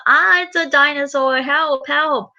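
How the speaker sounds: high-pitched play-acted panic; frightened dolly. A doll fleeing a toy dinosaur, in high mock panic. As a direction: squeal for help as a toy doll. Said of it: "Peppa Pig as dolly says".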